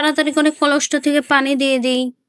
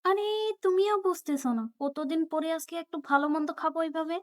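soft justification; 11 dB quieter than usual. Acted. Mother quietly justifies.

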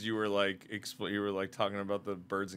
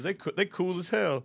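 zoo tour guide; 9 semitones higher, 4 dB louder than usual. Pretend. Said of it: "I'm gonna be like".

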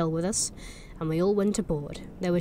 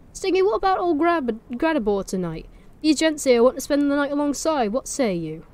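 put-on pushed Norfolk accent, calling across to a friend; broad Norfolk call; 6 semitones higher, 5 dB louder than usual. Villager calls his friend over, in broad Norfolk. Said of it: "push the accent".

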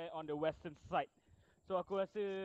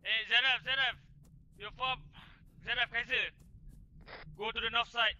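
shouting orders over battle; 5 semitones higher, 5 dB louder than usual. Real, not acted. Battle, calling teammates.

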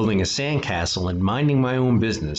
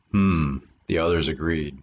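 usual speaking voice

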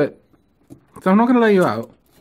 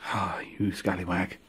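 ordinary delivery

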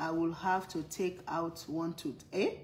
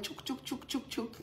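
clipper sound effect; 6 semitones higher, 7 dB quieter than usual. Retold or imitated. Imitates clippers cutting.

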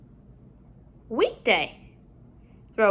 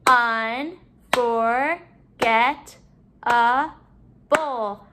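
"Unforgettable" very slowly, in five beats; syllable by syllable; much slower than usual. Shown as a demonstration. Teacher counting syllables in the word, broken up. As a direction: separate each syllable of "Unforgettable" with pauses. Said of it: "break up our syllables".

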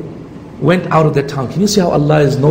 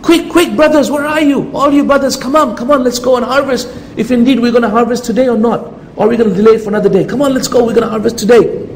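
much higher, urgent and hurried, rousing the brothers; eager rallying call; 7 semitones higher than usual. Acted. Brothers urging each other out to harvest, eager and high. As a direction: call them out like it's harvest morning.

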